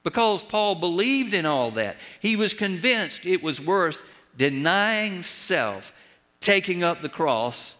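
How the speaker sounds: preaching. Acted. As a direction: drive the warning home to the flock. Preaching climax.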